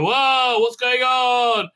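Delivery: high, slow hammed-up alarm; mock startled. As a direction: overplay the surprise. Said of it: "they go".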